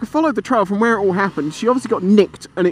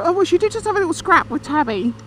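casual family reply; 10 semitones higher than usual. Retold or imitated. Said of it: "and so we go".

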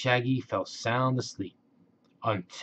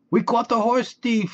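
Shaggy triumphant; 11 semitones higher, 3 dB louder than usual. Acted. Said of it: "Shaggy said".

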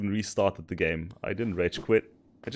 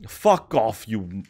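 ordinary talk

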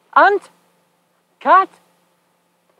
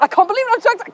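excited; 9 semitones higher, much faster than usual. Real, not acted.

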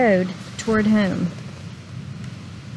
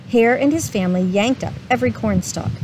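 plain reading voice

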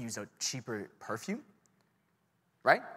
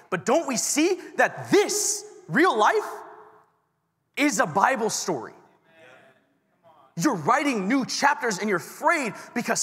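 higher, louder, slow preaching push; preacher urging the youth, higher and louder; 9 semitones higher, 5 dB louder, slower than usual. Acted. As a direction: drive the point home from the pulpit. Preaching climax.

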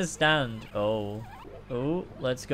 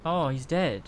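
ordinary talk, nothing marked

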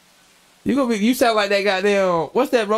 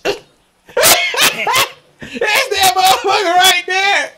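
high, loud mock scream of fright; yelling at a creepy robot in a horror film; hammed-up horrified shout; 13 semitones higher, 5 dB louder than usual. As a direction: shriek it like the scene scares you. Retelling creepy movie robot.

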